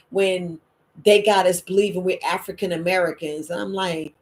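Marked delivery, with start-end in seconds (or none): none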